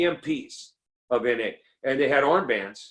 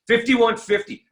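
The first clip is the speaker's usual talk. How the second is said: loud [name]; 11 semitones higher, 6 dB louder than usual. Shown as a demonstration. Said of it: "[name] album".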